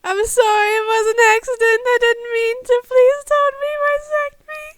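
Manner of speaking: high, loud, tearful begging; sobbing shout. Acted. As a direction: scream apologies through tears. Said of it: "he shouted in reply".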